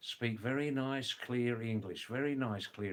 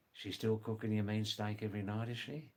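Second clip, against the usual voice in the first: "She's still cooking you mean steak" fast and run together in Aussie; Australian accent; 2 semitones lower, faster than usual. Shown as a demonstration. Teacher showing Aussie question about the wife, fast run-together Australian. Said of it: "fifty percent speak like that".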